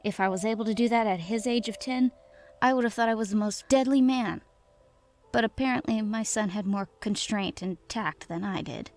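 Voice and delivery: ordinary delivery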